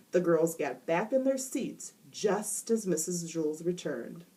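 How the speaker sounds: usual speaking voice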